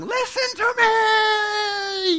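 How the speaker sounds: high, drawn-out shout; loud pleading holler, stretched out; yelling kid. Acted. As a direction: yell it like a child demanding attention, long and high. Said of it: "That kid's probably yelling".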